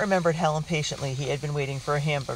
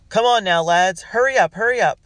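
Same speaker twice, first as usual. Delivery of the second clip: loud, higher urgent call; 6 semitones higher, 9 dB louder than usual. Acted. Colonel in the dream urges the lads to hurry, calling loudly. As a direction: rouse the sleeping lads. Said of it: "The colonel's voice was suddenly getting louder".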